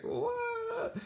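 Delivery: very high squeaked what; a shrill stunned reaction; baffled what. Retold or imitated. Listener reacting to Luna's odd remark, squeaking in disbelief.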